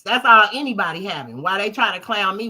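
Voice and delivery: ordinary delivery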